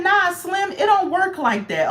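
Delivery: lightly mocked officer, pitched high; a hint of a street-wise guard turning visitors away.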